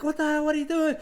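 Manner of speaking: high pitched disbelief at the distraction; a startled referee squawking at the chaos; shocked referee. Retold or imitated. Referee shocked by woman interfering in match.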